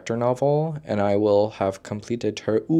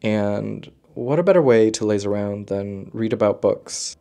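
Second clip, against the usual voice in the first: ordinary talk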